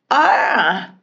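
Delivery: big, high, open yawn; loud acted yawn. A narrator's reading. Sleepless princess tiring at last, loud acted yawn. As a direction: let out a loud storybook yawn. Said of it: "and yawned".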